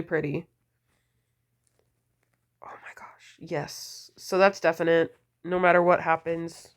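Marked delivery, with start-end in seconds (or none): 2.6-4.1 whispered delight. Real, not acted.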